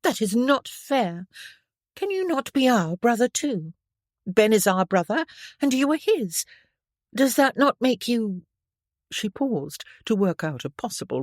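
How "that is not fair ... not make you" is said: put-on lightly raised girlish voice, pleading; a hint of a young lady protesting; eager young girl. A narrator's reading. Young Stephanie pleading with her older cousin, light girlish.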